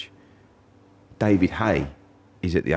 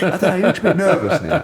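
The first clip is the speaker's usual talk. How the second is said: high, loud nervous squeal through laughter; mock nervous; 11 semitones higher, 5 dB louder, faster than usual. Same nervous answer after a laugh.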